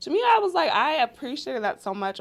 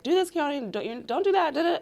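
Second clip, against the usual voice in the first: higher-pitched imitation of nagging orders; mock nagging; 8 semitones higher than usual. Mother imitating herself ordering her son about all day, mock nagging. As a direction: rattle off mock orders to a child.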